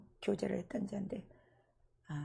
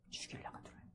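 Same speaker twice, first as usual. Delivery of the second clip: whisper; 14 dB quieter, faster than usual. Retold or imitated. Questioning the possessing ghost.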